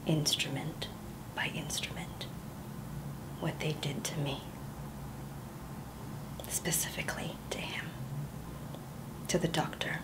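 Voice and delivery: hushed, unvoiced confession; whispered confession. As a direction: breathe it out as a secret nobody else may hear.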